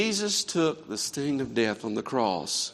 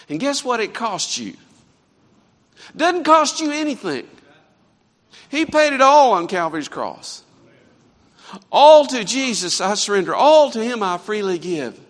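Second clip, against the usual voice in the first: much higher and louder, climactic pulpit cry; preaching at full force; 9 semitones higher, 10 dB louder than usual. Acted. Preacher calling the congregation to surrender, much higher and louder. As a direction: lift it to a triumphant peak.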